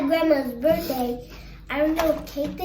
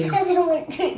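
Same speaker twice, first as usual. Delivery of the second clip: fast, giggling excuse; laughing; much faster than usual. Real, not acted. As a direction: rush it out through giggles. Child laughing, rushing.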